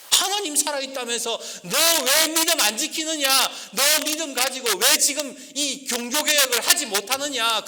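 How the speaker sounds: high, loud scolding rebuke; stern rebuke. Acted. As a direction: thunder it at him like a prophet. Wife rebukes Luther in sermon. (루터를 꾸짖는 아내, 높고 크게)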